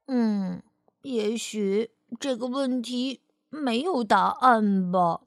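put-on slow, gloomy little boy; glum. A narrator's reading. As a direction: drag it out, downhearted. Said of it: "菲尔闷闷不乐地说".

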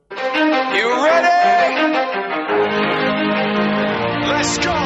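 very high, slow drawn-out call; hype announcer. Acted. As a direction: hype the crowd like an MC.

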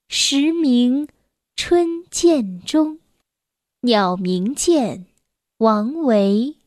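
very slow, measured classical poem reading; poetry recitation. Acted. Reader reciting a Tang poem to a child, slow declamation. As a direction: draw out each syllable, pause after every phrase.

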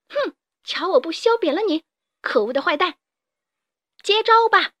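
high-pitched fighting menace building to a shout; vicious threat. A narrator's reading. Mole boss raises a branch at Carmelito, viciously threatening. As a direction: spit threats, ending on a loud, high challenge. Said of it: "恶狠狠地说道".

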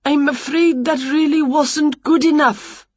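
high, lightly voiced faint apology; weak conductor. A narrator's reading. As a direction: let her down feebly, pitched high. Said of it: "said the conductor weakly".

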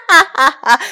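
high, loud mocking cackle; cackling laugh. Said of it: "青蛙们放肆的笑声".

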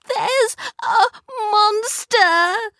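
put-on high, crying child's voice; sobbing. A narrator's reading. Kipper telling Mum and Dad, sobbing. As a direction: sob it out to his parents. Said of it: "he sobbed".

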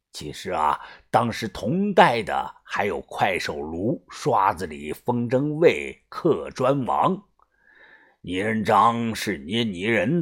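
low, slow elder voice; gang boss explaining. Acted. Boss explaining old craftsmen to Yunfeng, low and measured. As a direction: lay out the old names slowly and softly.

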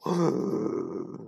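sharp scared intake of breath; frightened gasp. Acted. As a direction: gasp in fear. Chick trembling in fear.

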